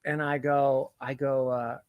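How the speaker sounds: usual speaking voice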